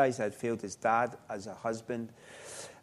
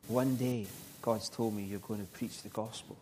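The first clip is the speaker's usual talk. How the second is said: softly retold whisper; 7 dB quieter than usual. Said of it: "she whispered to me".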